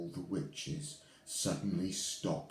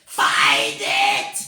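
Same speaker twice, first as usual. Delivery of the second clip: very loud scream; 6 semitones higher, 15 dB louder than usual. Acted. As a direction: scream the order. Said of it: "screamed the Grand High Witch".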